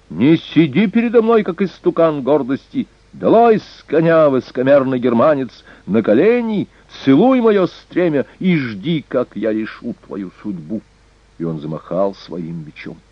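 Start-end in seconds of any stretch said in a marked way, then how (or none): 0.1-10.8 high, forceful, haughty orders; enraged command. A narrator's reading. Furious prince ordering the king off his horse, enraged command. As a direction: order him down in high-pitched rage. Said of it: "смотрел на взбешённого Эрнака".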